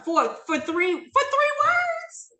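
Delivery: high, drawn-out disbelief; incredulous. Shown as a demonstration. Speaker amazed a friendship could end over three words, incredulous. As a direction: voice climbs sharply in slow astonishment.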